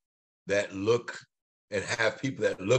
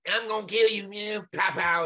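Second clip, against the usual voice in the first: much higher mock tough-guy threat with gun noises; sending up the violence on TV, playful mock thug; mock movie gangster; 12 semitones higher than usual. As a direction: ham up an action-movie killer, pow pow. Mocking violent TV movie lines.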